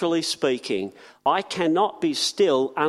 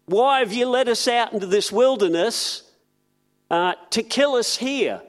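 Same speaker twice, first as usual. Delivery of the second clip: blaming; 6 semitones higher than usual. Retold or imitated. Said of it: "they start blaming Moses".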